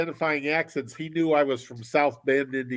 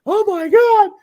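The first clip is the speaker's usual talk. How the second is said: very high, loud mock horror; imitating an appalled wife, voice shooting up; horrified wife; 9 dB louder, slower than usual. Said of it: "It just horrified her".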